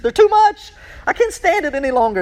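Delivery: can't contain the thrill, voice rising; overwhelmed. Real, not acted. Preacher overcome by the parallels, excited high voice. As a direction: pitch climbing high with excitement.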